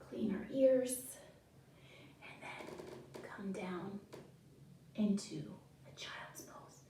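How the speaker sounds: put-on hushed whisper guiding the kids down; soft calming whisper. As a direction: whisper so the children settle. Whispered relaxation cue.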